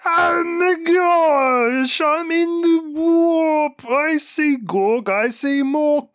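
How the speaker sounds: very high, loud, slow cry of rapture; wailing, awestruck orc psyker; ecstatic shouting orc. Pretend. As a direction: shriek like an orc seeing his gods. Weird boy ecstatic in warp.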